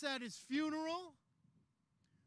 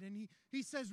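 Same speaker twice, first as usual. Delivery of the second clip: normal talking voice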